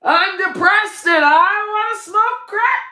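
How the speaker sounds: high, loud, laughing mock announcement; mock addict. Mocking someone who announces they want drugs, high and laughing. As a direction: ham it up like a cheerful junkie. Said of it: "just go and say".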